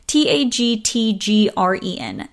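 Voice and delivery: usual speaking voice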